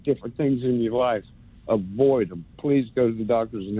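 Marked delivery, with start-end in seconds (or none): none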